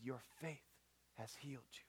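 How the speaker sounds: very soft, low pronouncement; tender hush. Acted. Jesus healing the blind man, tender hush. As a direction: say it gently, almost under the breath.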